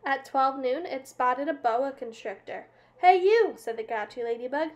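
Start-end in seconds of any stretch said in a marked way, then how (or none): 3.0-3.5 loud, high call of challenge. A narrator's reading. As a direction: yell it out to pick a fight. Grouchy ladybug picks a fight.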